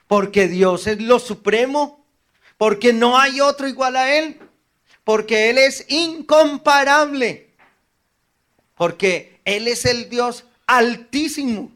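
climbing preacher's cry; building to a shout. Real, not acted. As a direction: preach it up to full force.

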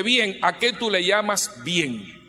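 drawn-out, slow priestly question; slow, skeptical priest; slow probing question. Retold or imitated. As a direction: ask it slowly and pointedly.

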